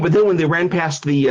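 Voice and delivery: ordinary delivery, nothing marked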